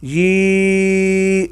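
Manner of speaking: ی held as one long even eee. Shown as a demonstration. Teacher demonstrates the long vowel sound. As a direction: hold ی out long and level. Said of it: "یا زیر یا".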